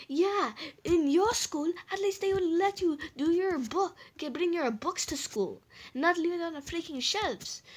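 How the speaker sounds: high-pitched indignant mother; angry mom impression. Teller mimicking mom complaining to brother about school, angry. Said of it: "she was, like, freaking angry".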